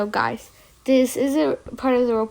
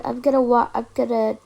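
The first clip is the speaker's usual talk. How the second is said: normal talking voice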